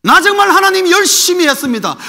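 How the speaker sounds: high, loud insistent plea; Elijah's protest. Retold or imitated. Preacher voicing Elijah protesting to God, high and loud. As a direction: insist on your effort.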